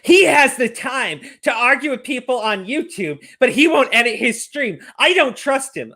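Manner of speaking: a hint of mock accusing girlfriend; mocking her whine. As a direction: lightly mimic a suspicious complainer. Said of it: "she's like".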